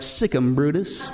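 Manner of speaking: low, deadpan parrot voice; lightly voiced parrot delivering the punchline; parrot's reply. Pastor telling the joke gives the parrot's reply, low and deadpan. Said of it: "the parrot replied".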